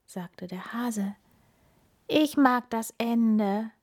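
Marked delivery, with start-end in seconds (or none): none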